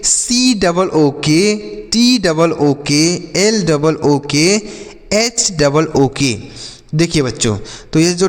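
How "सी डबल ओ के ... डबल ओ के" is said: letters spelled out slowly, one by one; slow classroom letter-by-letter dictation; slow spelling out. Shown as a demonstration. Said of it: "देखिए यहाँ पर लिखा गया".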